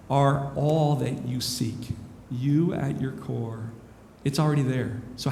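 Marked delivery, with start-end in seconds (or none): 0.1-3.7 slow, spaced words; said slowly. Shown as a demonstration. To the audience, slowly spelling out the point. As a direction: let each word land slowly. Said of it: "I'll say this slowly".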